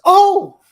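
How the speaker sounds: sudden loud, high "oh!"; startled yelp. Retold or imitated. Woman reacting to big moments on screen, startled yelp. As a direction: imitate a jumpy viewer's exclamation.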